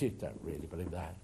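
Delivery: low, soft sneer; mocking. Preacher voicing the Athenians mocking Paul, low sneer. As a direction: scoff at the idea. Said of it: "some mocked".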